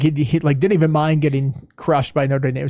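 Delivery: ordinary talk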